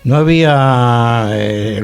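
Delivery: ordinary talk, nothing marked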